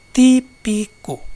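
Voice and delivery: típico slowly split into tí-pi-co; syllable by syllable. Shown as a demonstration. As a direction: give típico three separate slow beats. Exemplo de divisão em sílabas. (típico, sílaba por sílaba, devagar)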